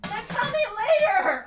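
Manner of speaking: high, loud outburst; exasperated shout. Real, not acted. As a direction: yell it back, fed up. Yells back at interrupting friend.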